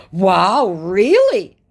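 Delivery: hammed-up disbelief; mock shock. Scammer shocked she doesn't know him, mock astonishment. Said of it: "Why are you so shocked?".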